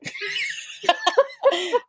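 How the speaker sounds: bursts into a high shrieking laugh; can't hold back a high laugh. Real, not acted. Laughing at her own story.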